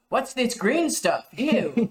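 high-pitched, drawn-out mock disgust; disgusted shopkeeper. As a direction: recoil in a high, puzzled voice. Acts out Canadian shopkeeper refusing foreign money.

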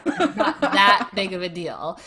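laughing through the words. Real, not acted. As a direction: brush it off with a laugh. Laughing self-mockery.

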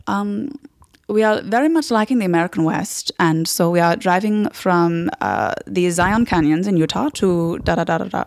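careful German tourist; German-accented itinerary, trailing into filler; slight German accent. Retold or imitated. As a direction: lay out travel plans in a slight German accent. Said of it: "slightly German".

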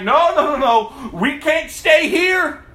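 urgent plea. Retold or imitated. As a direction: plead to go home. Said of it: "she was like".

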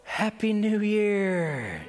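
slow gleeful happy new year. Retold or imitated. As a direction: savor each word, pleased with himself.

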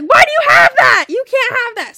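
high, loud scolding; stern dad. Retold or imitated. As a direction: bark it at the kids. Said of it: "Derek's like".

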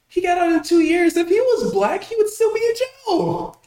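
high-pitched indignant outburst; incredulous protest. Real, not acted. Arguing with co-host over a killer's short sentence, incredulous. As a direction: voice shooting way up in disbelief.

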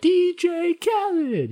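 high, drawn-out hype call; [name] drop. Retold or imitated. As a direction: stretch it up high like a DJ signature tag. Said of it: "you could throw in".

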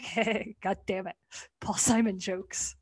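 low, soft grumbling curse at the pun; muttered groan. Retold or imitated. Viewer groaning quietly at the [name] joke, low muttered curse. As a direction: grumble it under your breath, fed up. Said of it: "And I was like".

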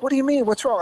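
worried surprise. Retold or imitated. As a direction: imitate the wife's sudden alarm. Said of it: "And my wife's like".

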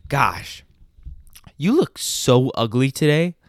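loud, drawn-out mock insult; imitating a brazen friend; confident insult. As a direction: announce the insult boldly, slowly. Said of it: "so confident".

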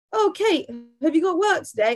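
high, bright questioning voice; mock chirpy question. Sister asking Kate about work, high and chirpy. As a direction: ask it sweetly. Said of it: "that stupid question might be".

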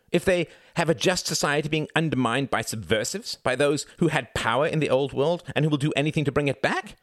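heated high-pitched harangue; impassioned crying out. A narrator's reading. Reginald defending the revolution, impassioned. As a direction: argue passionately, pitch kept high. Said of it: "cried Reginald".

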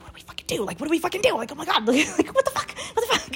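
hushed start rising to high squeals; frantic, squeaky, breaking into laughter; retold panic. Sitting in the tent near wolves, high hushed panic.

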